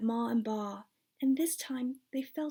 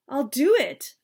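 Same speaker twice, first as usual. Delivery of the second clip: louder, determined vow; eager vow; 3 semitones higher, 8 dB louder than usual. A narrator's reading. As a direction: blurt out, resolved. Boy eagerly accepts.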